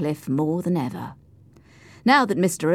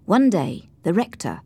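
ordinary delivery, nothing marked

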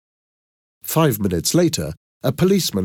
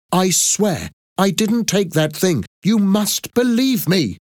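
high, urgent protest, slowing at the end; desperate pleading; 12 semitones higher, 3 dB louder than usual. A narrator's reading. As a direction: plead to be believed. Accused woman desperately protests innocence.

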